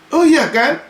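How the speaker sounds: ordinary talk, nothing marked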